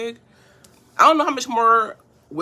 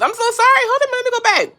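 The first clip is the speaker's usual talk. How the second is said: high, loud, rushed apology; flustered apology; 9 dB louder than usual. Real, not acted. Apologizing to viewers for missing comments, high and loud. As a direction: yelp out sorry, flustered. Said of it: "I am missing your guys' comments".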